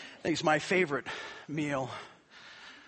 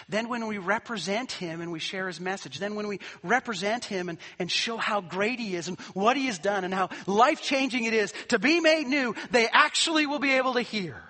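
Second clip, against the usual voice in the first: higher and louder, building preaching; 6 semitones higher, 6 dB louder than usual. Acted. Pastor urging his church to show Jesus, rising preaching. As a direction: drive the message home to the congregation.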